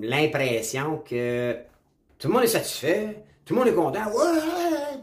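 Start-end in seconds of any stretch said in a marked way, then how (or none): 2.2-4.0 higher-pitched imitation of a happy crowd; mock jubilation. Describing the opposition parties' supporters, mock jubilation. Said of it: "tout le monde criait". 4.0-5.0 very high, drawn-out imitation cheer; mock crowd cheer. Imitating cheering crowds at opposition parties, mock high cheer. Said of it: "tout le monde criait".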